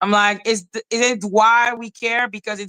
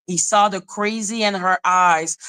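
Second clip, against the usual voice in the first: ordinary delivery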